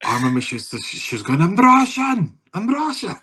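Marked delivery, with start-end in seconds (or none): 1.4-3.2 high-pitched mock Russian introduction; imitated Russian clubber. Russian introducing herself to someone without shared language, high imitation. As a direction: squeal it out as an excited introduction.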